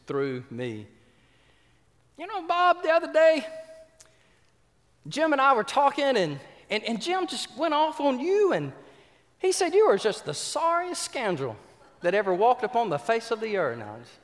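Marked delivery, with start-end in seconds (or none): 2.2-13.8 high, loud mock gossip voice; a gossip's loud high-pitched tattle; gossiping friend. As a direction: ham up a nosy friend passing on dirt. Retold gossip about Bob.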